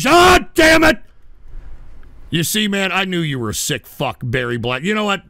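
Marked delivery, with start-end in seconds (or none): 0.1-1.0 loud, high cry of disgust; real furious yell; angry outburst. Real, not acted. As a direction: blurt it out in disgust. Outburst at the viewer's video.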